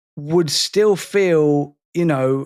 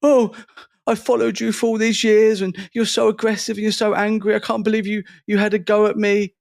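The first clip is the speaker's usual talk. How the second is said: mock offended follower; 6 semitones higher, faster than usual. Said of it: "And they go".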